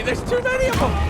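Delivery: very high panicked shout; panicked yell. Real, not acted. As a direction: scream it as they swarm. Swarmed by enemies.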